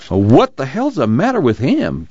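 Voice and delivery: slow, high-pitched exasperated question; narrated Tom, voice swooping up; demanding. A narrator's reading. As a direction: demand it with drawn-out bafflement. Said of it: "Tom demanded".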